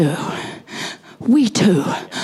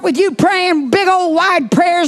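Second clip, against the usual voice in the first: raised, louder preaching voice; 5 semitones higher, 4 dB louder than usual. Acted. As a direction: call the congregation up with fervor. Pastor calls people forward.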